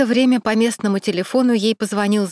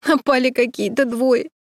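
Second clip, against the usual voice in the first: sobbing; 5 semitones higher than usual. A narrator's reading. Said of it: "всхлипнула Саша".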